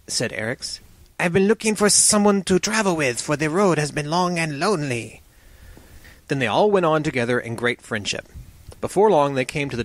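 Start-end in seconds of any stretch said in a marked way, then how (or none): 1.2-5.2 sibilant snake voice, pitched a little up; serpent man. Acted. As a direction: hiss the s sounds as a snake turned man. Serpent man joins the party.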